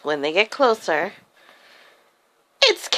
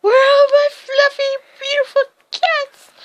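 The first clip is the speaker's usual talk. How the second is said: high, screechy cat-lady wail; screaming old lady; 6 dB louder than usual. Acted. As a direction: shriek in search of lost pets. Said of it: "She starts screaming".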